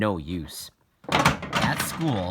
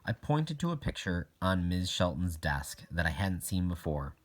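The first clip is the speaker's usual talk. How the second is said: ordinary delivery, nothing marked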